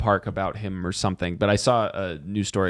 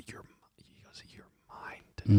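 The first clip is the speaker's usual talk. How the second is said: breathy lover's whisper in the ear; seductive whisper; 2 semitones lower, 4 dB quieter than usual. Retold or imitated.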